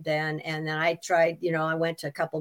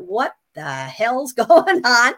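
laughing disbelief; 4 semitones higher, 7 dB louder, much slower than usual. Retold or imitated. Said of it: "And I thought".

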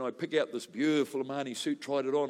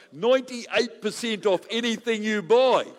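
higher, louder, slow excited punchline; excited reveal; 7 semitones higher, 8 dB louder, slower than usual. Real, not acted. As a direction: savour the amazing news, slowly. Excited punchline of his story.

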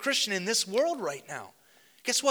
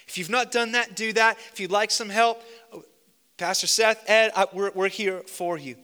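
loud urgent invitation; fervent preaching; 5 dB louder than usual. Real, not acted. Preaching at full force.

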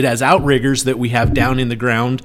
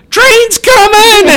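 high, loud, drawn-out alarm yell; frantic shout; 10 dB louder, much slower than usual. Retold or imitated. Doogie yelling that the train is coming, a loud high shout. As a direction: yell the warning across the tracks. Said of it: "and Doogie's like".

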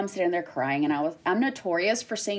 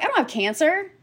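high, incredulous denial; retold disbelief; 11 semitones higher, 3 dB louder than usual. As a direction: re-enact baffled denial in a raised pitch. Retold denial after shock.

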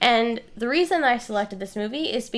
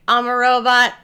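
stiff mechanical robot voice, slightly louder; 8 dB louder than usual. Shown as a demonstration. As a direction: say it like a clumsy robot. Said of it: "I'm talking like a robot".

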